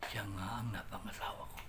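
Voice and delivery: confiding whisper to the audience. Real, not acted. Storyteller revealing his wife, in a confiding whisper. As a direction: let them in on the secret, hushed.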